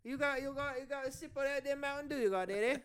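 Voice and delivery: high-pitched, hammed-up Cockney; Cockney accent. Host riffs to his laughing co-host, mock Cockney accent. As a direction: play a chatty Cockney caricature. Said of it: "Cockney as shit".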